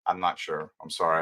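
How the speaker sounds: low, soft, weary admission; tired. Real, not acted. Streamer apologizing to viewers for rambling, tired. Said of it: "I'm getting tired".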